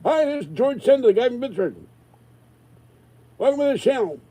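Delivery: squeaky pretend channel welcome; high put-on intro voice. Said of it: "And people go nuts".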